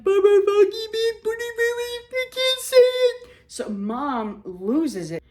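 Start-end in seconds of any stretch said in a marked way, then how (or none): 0.0-3.3 mock flustered self. Herself fumbling the intercom announcement, squeaky and sung. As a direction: squeal it out on the intercom, tongue-tied. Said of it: "And here I am".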